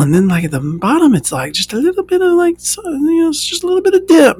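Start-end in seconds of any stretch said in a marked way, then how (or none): 1.5-4.4 high squeaky disbelief. Real, not acted. Telling listeners about the tiny dip, high squeaky voice. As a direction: squeak it out, amazed how little.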